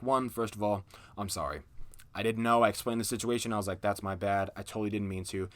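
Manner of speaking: low, soft, earnest sorry; sincere apology. Retold or imitated. Apologizing to the angry boyfriend, soft and sincere. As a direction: apologize softly and mean it. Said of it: "And I was like".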